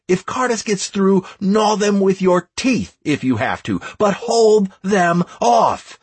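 high, heated rebuke; angry dressing-down. A narrator's reading. Leader berating Plinto and would-be deserters, angry. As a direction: scold them hard and drive them back in line.

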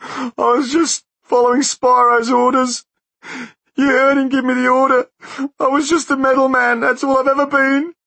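very high and loud, pleading through tears; sobbing. A narrator's reading. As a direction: break down begging. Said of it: "he sobbed".

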